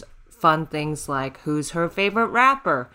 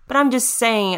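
ordinary talk, nothing marked

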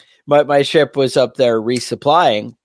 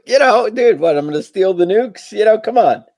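much higher, sarcastic disbelief; mock incredulous; 8 semitones higher than usual. Driver mocking the guard's paranoia, higher sarcastic disbelief. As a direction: throw up your hands in mock disbelief. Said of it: "I'm like".

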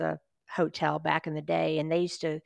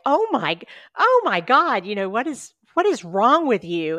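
higher and louder, a mock-aghast outburst; retold shocked exclaim; 4 semitones higher, 6 dB louder than usual. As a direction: burst out in disbelief, half laughing. Friend reacts to the highlighter reply.

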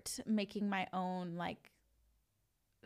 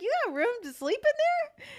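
much higher, bright questioning voice; high-pitched question; 13 semitones higher, 6 dB louder than usual. Retold or imitated. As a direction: ask it way up high. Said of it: "I was like".

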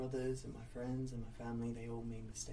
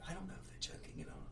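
nervous whisper; 5 semitones higher, 8 dB quieter, much faster than usual. Retold or imitated. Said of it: "you're just like".

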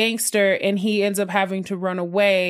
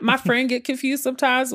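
normal talking voice

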